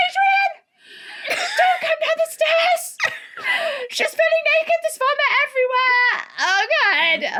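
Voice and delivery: high, loud half-crying shriek from the stairs; frantic mum. Retold or imitated. Mum on the stairs yelling at dad to stay away, high and shrieking. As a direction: shriek a warning to the husband, laughing through it. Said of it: "And my mum's like".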